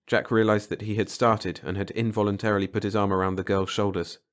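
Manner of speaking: ordinary delivery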